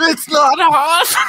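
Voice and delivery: loud, slow, high yell; hammed-up shriek; drawn-out alarmed shout; mock scream. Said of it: "He screams".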